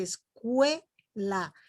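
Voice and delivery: "escuela" muy lento, con la E resaltada y la U débil; pronunciación lenta. Shown as a demonstration. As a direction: separa "escuela" despacio, marcando la E. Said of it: "La E es la que está resaltando más". ("escuela" despacio, la E más fuerte)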